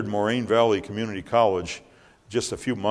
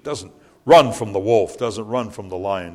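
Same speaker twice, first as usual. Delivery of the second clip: ordinary delivery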